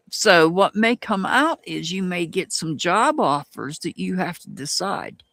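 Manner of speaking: ordinary talk, nothing marked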